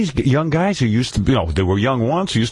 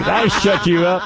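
high honking parody of the interviewer; much higher honking mock voice, slow at the end; mock car-horn voice; 9 semitones higher than usual. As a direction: squeal it out like a car horn, laughing. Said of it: "He sounds like a car horn now".

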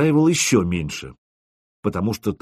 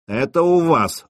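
slow, smug, higher pitched jab; smirking; 6 semitones higher, 3 dB louder than usual. A narrator's reading. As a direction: smirk through it. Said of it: "ухмыльнулся Горластый".

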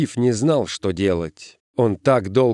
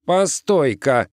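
higher, drawn-out holler; mild yell; 6 semitones higher, slower than usual. A narrator's reading. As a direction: stop someone with a stretched call. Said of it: "завопил один из ребят".

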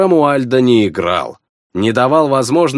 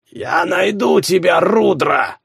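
raspy, higher wheezed threat; hoarse; 5 semitones higher than usual. A narrator's reading. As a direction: rasp it out, wounded and spiteful. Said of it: "прохрипел он".

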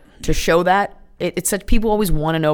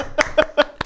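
laugh; 10 semitones higher than usual. Real, not acted. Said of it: "Well done".

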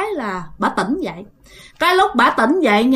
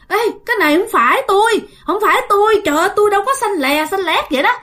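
high, quick startled denial; high, fast panicked protest; alarmed protest; 7 semitones higher, faster than usual. Retold or imitated. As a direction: cry out in disbelief at the body. Grandma sees her own corpse.

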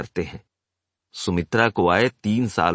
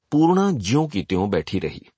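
ordinary delivery, nothing marked

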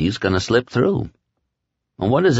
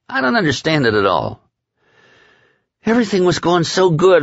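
a higher, worked-up Lloyd; a hint of a rattled henchman; lightly voiced Lloyd; 7 semitones higher than usual. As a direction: Lloyd venting, slightly higher.

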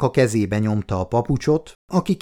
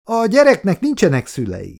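a curt, louder snap a bit higher; barked; 8 semitones higher, 3 dB louder than usual. A narrator's reading. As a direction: bark it out at him. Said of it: "vakkantotta oda az asszony".

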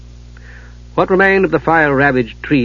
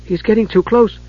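urgently; 8 semitones higher than usual. A narrator's reading. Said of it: "Usha said urgently".

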